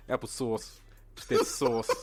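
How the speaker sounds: mock New York accent on applesauce. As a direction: lean hard into the New York vowels. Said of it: "your New York accent just comes dead to the forefront".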